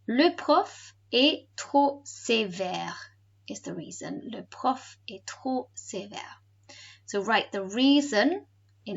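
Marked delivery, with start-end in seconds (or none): 0.1-3.0 very slow, word-by-word French; slower. Shown as a demonstration. Teacher replaying a listening answer for pupils, slowly. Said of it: "then I'll say it slower". 4.3-6.4 slow, softer word-by-word French; slower. Shown as a demonstration. Teacher repeating the reason for pupils, slowly. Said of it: "then I'll say it slower".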